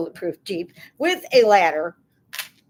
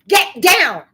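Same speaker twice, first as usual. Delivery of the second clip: sharp shout; 10 semitones higher, 10 dB louder than usual. Real, not acted. Scolding the cat.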